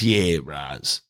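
low, lazy stoner voice; laid-back stoner. Retold or imitated. Stoner worker answers a surprised customer, low and laid-back. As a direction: drop it low and unbothered, like a snowboarder.

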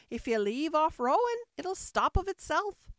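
high, loud curt instruction; testy Sheep. A narrator's reading. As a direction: explain impatiently as the cross Sheep. Sheep instructs Alice crossly.

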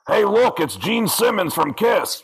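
high, slow, self-announcing Gene voice; [name] voice. Retold or imitated. Gene arrives at mini golf, announcing himself in a slow impression. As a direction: announce yourself like a rock star, slowly. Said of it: "he did it himself".

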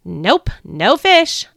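slow, loud, fed-up answer; disgusted grunt. Acted. Peter answers the stranger, grunting in disgust. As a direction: grunt it out in disgust, dragging the words. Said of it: "I grunted in disgust".

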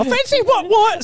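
hammed-up British accent; mock British accent. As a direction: squeal it like a silly Brit. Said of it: "sound like cockney British people".

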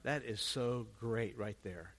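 ordinary delivery

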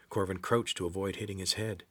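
ordinary delivery

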